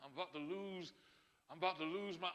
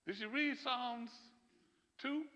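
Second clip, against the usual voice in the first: normal talking voice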